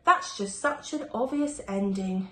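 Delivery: plain reading voice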